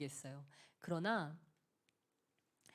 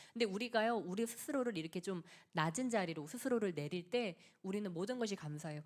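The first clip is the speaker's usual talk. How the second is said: usual speaking voice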